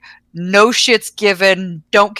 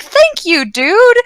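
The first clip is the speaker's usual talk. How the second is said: grateful; 12 semitones higher, 5 dB louder, much slower than usual. Retold or imitated. Retelling grateful reply to clerk.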